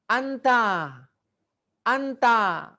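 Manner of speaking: model word reading. Shown as a demonstration. Teacher calling out the Arabic word for pupils to repeat, clear and high. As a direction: call the word out clearly to copy.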